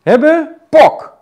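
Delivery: high pok on the hit; counted cue rhythm. Shown as a demonstration. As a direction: snap out the stroke sound. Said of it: "je voelt dit punt".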